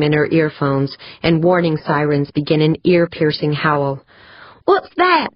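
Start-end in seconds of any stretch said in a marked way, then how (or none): 4.6-5.3 high-pitched shouted question; alarmed yell. A narrator's reading. Pancho to crewmate over helmet microphone, high alarmed yell. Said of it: "she yelled into her helmet microphone".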